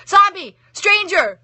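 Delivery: high shrieking yell; high-pitched scream. A narrator's reading. Little girl screams at Death, high and loud. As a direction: shriek it in alarm. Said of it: "letting out a scream so high-pitched".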